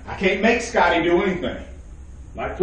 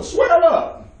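much higher, punched preacher's jab; mock puffed-up outburst; 14 semitones higher, 4 dB louder than usual. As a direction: throw it out high like a challenge. Acts out brothers bristling at him.

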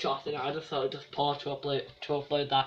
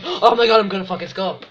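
mock excited shout; 4 semitones higher, 11 dB louder, faster than usual.

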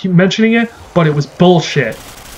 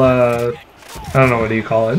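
usual speaking voice

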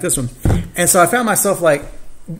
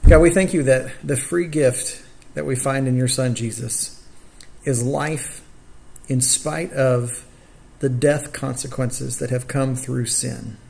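prayer; 3 semitones lower, 4 dB quieter than usual. Real, not acted. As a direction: pray aloud gently, pausing between phrases. Leads the opening prayer.

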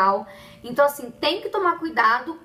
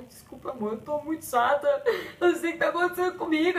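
half-crying sorry to her husband; wife apologizing to husband, mildly teary; tearful apology; 4 semitones higher than usual. Retold or imitated. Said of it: "Eu começo a chorar".

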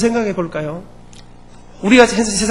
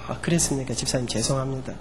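meek apology; 7 semitones lower, 10 dB quieter than usual. Retold or imitated. Pastor apologizes to upset church member.

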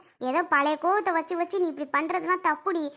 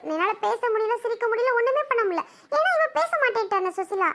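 high-pitched, hurt protest; aggrieved complaint; 5 semitones higher than usual. Acted. Young woman complains the in-law will not talk to her, aggrieved. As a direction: pour out the grievance in a raised pitch.

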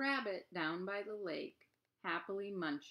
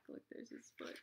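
soft whispered pointing aside; 15 dB quieter, faster than usual. Real, not acted. Reader whispering to the listening child about the picture.

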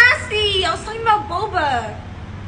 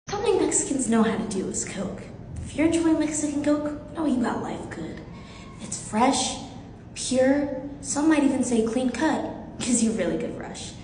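ad read; 5 semitones lower, 7 dB quieter than usual. Pretend. Ad read for Mexican Coke.

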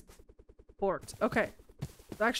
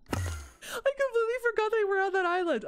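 squealing laugh, then high bright words; delighted high laugh; 14 semitones higher than usual. Real, not acted. She laughs high on finding the ladybugs again. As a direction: giggle with joy.

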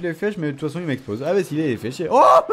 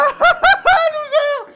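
a sudden high, loud laugh; high laugh burst; 7 dB louder than usual. Real, not acted. Gamer mocking his own loss, squealing laugh.

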